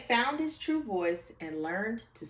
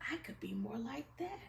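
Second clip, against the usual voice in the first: quick whisper to himself; whispered thought; 3 semitones higher, 12 dB quieter, faster than usual. A narrator's reading. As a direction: breathe it out fast, a secret wish. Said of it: "thought Mouse".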